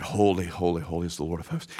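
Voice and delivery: soft, hushed awe; seraphim crying holy. Acted. Preacher voices seraphim around the throne, hushed and awed. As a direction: breathe it in reverent hush. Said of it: "they cried".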